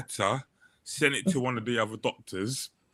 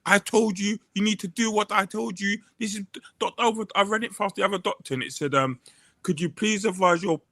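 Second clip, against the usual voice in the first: higher-pitched, fast, bossy mimicry of the PA; mock her insisting she was told to send it, voice pitched up; pitched-up imitation of a PA reading out a letter; bossy woman, higher; 8 semitones higher than usual. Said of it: "And the woman goes".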